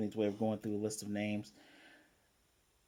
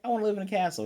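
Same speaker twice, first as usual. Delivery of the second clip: lightly voiced little girl, pitched higher; a hint of an eager little girl, higher; childish higher wish; 8 semitones higher, much faster than usual. Little Anya asks Papa for a castle, lightly higher childish voice. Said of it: "Anya's like".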